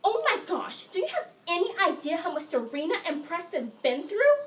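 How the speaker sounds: high, loud, melodramatic wail; hysterical outburst. Acted. Sick sister rants to mother about TV couple, hysterical. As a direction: overwrought teen rant climbing to a shriek. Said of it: "find my sister hysterical on her bed".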